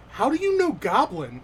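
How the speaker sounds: high, loud, astonished outburst; shocked. Acted. Goblin startled that Mortimer speaks its language, shocked and loud. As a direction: blurt it out in astonishment. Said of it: "looks up at you shocked and says".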